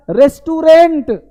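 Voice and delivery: रेस्टोरेंट with a full "res-tu-RENT"; wrong pronunciation. Shown as a demonstration. Teacher quotes what every child says, mispronounced. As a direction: say रेस्टोरेंट ending in hard "rent". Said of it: "रेस्टोरेंट नहीं है".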